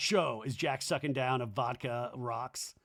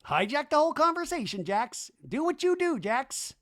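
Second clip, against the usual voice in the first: much higher aggrieved buddy voice; Danny impression; 11 semitones higher, 3 dB louder than usual. Danny confronting Jax about parties, high aggrieved voice. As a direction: whine it high like a hurt friend left out. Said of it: "Can I talk yet".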